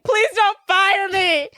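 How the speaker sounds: high, loud, drawn-out plea; mock pleading. Assistant begs boss not to fire her, mock pleading. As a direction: imitate a panicked employee begging, slow and high.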